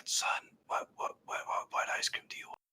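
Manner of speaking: unvoiced ASMR whisper, dad voice; whispered asmr role play. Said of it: "some A S M R roleplay".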